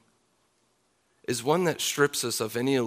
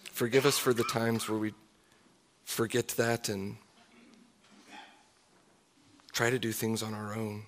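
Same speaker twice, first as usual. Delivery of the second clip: closing prayer. Acted.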